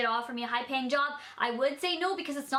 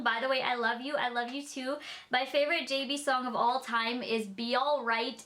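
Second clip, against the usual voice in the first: ordinary delivery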